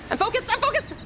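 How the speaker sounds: high, rising panicky insistence; frantic self-assurance. Real, not acted. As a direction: insist it as control slips away. Self-talk escalating into panic.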